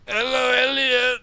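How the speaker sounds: put-on urgent wail from an addled old teacher. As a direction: make the blank greeting a cry for help. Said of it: "that sounds a bit urgent".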